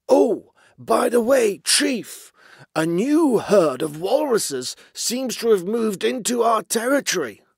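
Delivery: high, loud, forced-casual voice; nervous underling voice. A narrator's reading. Basil breaking news of rivals to his chief, high nervous underling voice.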